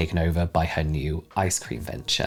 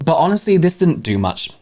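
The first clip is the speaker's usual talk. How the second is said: ordinary talk, nothing marked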